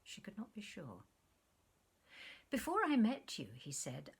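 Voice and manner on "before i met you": sudden high loud start; eager outburst. Acted. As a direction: burst in eagerly. He bursts out to her.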